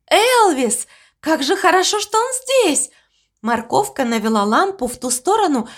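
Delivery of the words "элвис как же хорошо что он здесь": high, relieved and delighted; joyful cry of relief; excited. Acted. Friends relieved to find Elvis. (радостно и с облегчением)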